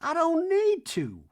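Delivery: high, slow hammed-up Reba; lofty slow mock-mom rant. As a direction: drone it like a grand old dame. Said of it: "Reba in scene".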